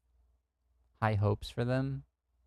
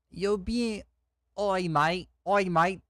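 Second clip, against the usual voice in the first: high, loud mock Australian; Australian accent; 7 semitones higher, 4 dB louder, slower than usual. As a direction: put on a hammed-up Aussie accent. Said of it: "I can't even do an Australian".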